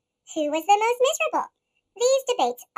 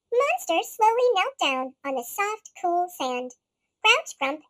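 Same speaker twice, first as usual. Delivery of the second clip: plain reading voice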